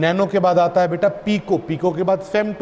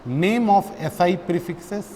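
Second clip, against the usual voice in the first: slow dictation; much slower than usual. Shown as a demonstration. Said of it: "dictating slowly to write down".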